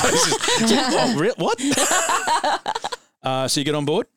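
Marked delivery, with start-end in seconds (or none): 0.0-2.8 high-pitched giggling; laughing. Real, not acted. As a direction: laugh through the words. GM cracks up laughing.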